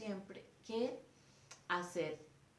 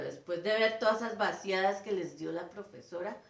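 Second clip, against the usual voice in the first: ordinary delivery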